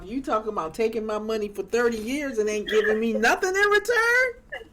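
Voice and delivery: usual speaking voice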